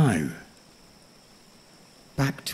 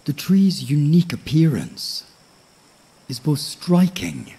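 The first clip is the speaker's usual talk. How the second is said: nature documentary. A narrator's reading. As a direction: lull the listener toward sleep. Sleep story narration of tree.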